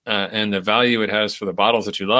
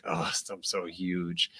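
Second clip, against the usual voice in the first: slow, much softer mock moan; mock whiny complaint; 3 semitones lower, 12 dB quieter, much slower than usual. Mocking a swimmer stuck in the box, slow soft moan. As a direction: sigh it out like someone fed up with their size. Mocking someone who feels huge.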